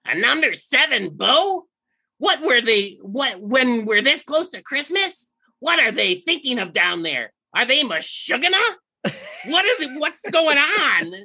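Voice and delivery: a pompous little general, scandalized; elf general impression. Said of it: "Okay, I'm gonna try this".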